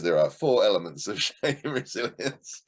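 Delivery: breaking into a laugh mid-sentence; laughing while reading. Real, not acted. Reading the fourth element to Coralie, laughing. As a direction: chuckle through the words, amused. Amused reading out her list.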